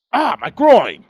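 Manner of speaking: high, loud hurt yelp; mock cry of pain. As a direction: cry out as if hit in the groin. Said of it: "Did you at least say".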